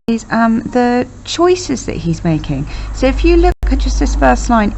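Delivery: ordinary talk, nothing marked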